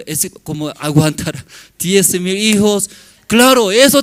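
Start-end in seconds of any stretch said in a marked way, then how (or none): 0.1-2.9 laughing. Real, not acted. Joke about his wife.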